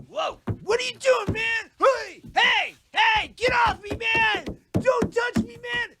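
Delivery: high, loud outraged yelling; belligerent drunk. Acted. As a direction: shout him off you, furious. Resisting being frisked, shouting.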